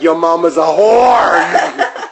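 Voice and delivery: slow, laughing jeer; giggling fake insult; laughing mock taunt. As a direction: sneer it through laughter. Taunting the child, joking.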